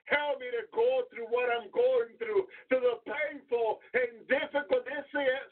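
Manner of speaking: high, pleading prayer; fervent prayer. Acted. Preacher leading the church in a pleading prayer. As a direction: lift the plea up to God.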